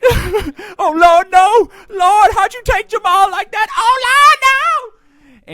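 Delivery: high, loud hammed-up wailing sob; mock bawling. Mourner at the podium wailing over Jamal, hammed-up bawling. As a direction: wail it like a grieving mourner overdoing it. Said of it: "bawling their eyes out".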